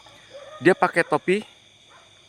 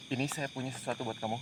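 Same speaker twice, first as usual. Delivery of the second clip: soft coaxing offer; 3 semitones lower, 14 dB quieter than usual. Real, not acted. Offering gift to the spirit.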